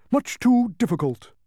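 very high, loud humbug; high-pitched emphatic agreement; emphatic bug. A narrator's reading. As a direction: echo it with pompous emphasis. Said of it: "emphasized the bug".

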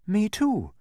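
put-on high-pitched plaintive boy; complaining. A narrator's reading. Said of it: "complained Milo".

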